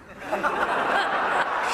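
breathy high laugh at her; high mocking laugh. Scientists laughing at the woman, high mock laugh.

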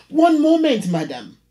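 sharp, high, loud start, dropping off; curt landlady. Acted. Landlady about to hang up on the caller, sharp and loud. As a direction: bark the first words then clip away.

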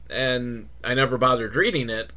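ordinary delivery

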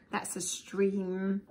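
plain reading voice

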